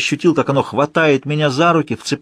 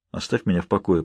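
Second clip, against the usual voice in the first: low, soft grumble; grumbled mutter; 5 semitones lower, 6 dB quieter than usual. A narrator's reading. Medium wanting to be left alone, grumbling. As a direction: mumble it to push someone away. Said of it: "пробурчал он".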